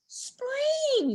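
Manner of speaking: very high sing-song word; high chirp. A narrator's reading. Groundhog telling the grumpy pig, chirpy. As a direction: sing it out hopefully.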